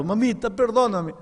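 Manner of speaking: high, tearful plea; crying child. Acted. Kneeling boy begging mother's forgiveness, high tearful plea. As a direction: sob it out on his knees.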